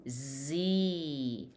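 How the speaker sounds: "z" said as a long zee; thick buzzed "z" running into a held ee. Shown as a demonstration. Teacher adds e to the letter, long zee. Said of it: "we pronounce it by adding e".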